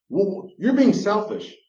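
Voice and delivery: high, loud, slow accusation; accusing spouse. Retold or imitated. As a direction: throw the blame back indignantly.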